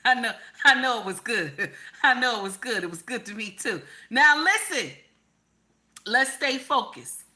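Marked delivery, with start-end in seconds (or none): 0.0-2.8 laughing through the words. Real, not acted. Laughing at her own bedroom memory, giggling. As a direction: giggle it out, amused.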